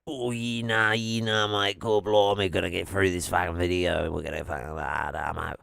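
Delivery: broad fake Australian, dropping in pitch and fading out; mock Australian accent. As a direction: ramble like a cartoon Australian. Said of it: "to do Australian without fucking being angry".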